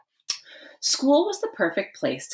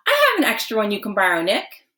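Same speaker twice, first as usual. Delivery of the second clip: fast, loud eager blurt; 5 semitones higher, 5 dB louder, faster than usual. Acted. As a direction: rush it out eagerly, voice up. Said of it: "John blurted out".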